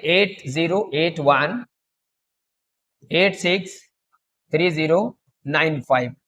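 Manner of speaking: number read in chunks, slowly, with long pauses; slow dictation. Shown as a demonstration. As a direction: dictate slowly so they can write it down. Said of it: "तो लिख लीजिए".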